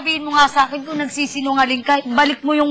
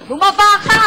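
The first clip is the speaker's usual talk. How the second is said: shouted order; 6 semitones higher, 5 dB louder than usual. Acted. Cop barking orders.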